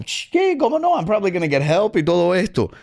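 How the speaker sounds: high startled squeak; young cook asked to work the grill, high surprised squeak. Retold or imitated. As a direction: leap up high in disbelief.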